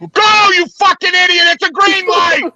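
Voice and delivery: furious bark at the driver ahead; road rage shout. Real, not acted. Cab driver yelling at a car stuck at a green light, furious shout. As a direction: yell it out the window at a stalled car.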